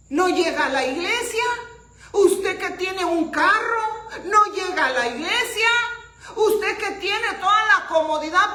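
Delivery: loud, high, challenging questions; full-force preaching. Real, not acted. Preacher rebuking those who skip church, full-force preaching. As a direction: confront the congregation at full volume.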